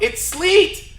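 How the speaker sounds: high, loud warning shout, laughing at the end; girlfriend's yell. Retold or imitated. Girlfriend yelling to stop the boyfriend running into sleet. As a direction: yell it across the tarmac to stop him. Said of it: "my girlfriend yell out".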